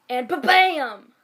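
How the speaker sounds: loud slow "ba-bam" with a big flourish; hammed-up ba-bam. Reading the fanfic's odd line aloud, hammed-up. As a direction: make it a grand reveal. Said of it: "It literally just says".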